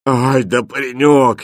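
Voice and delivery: slow, weary gasp of admiration; out of breath. A narrator's reading. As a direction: praise him while barely breathing from fatigue. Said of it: "едва дыша от усталости". (едва дыша от усталости)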